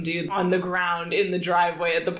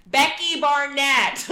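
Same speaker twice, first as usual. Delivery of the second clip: louder and slow, over a loud bar; repeated louder; 8 semitones higher, 4 dB louder, much slower than usual. Retold or imitated. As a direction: shout the name again so he hears it. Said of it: "he's like, "What?".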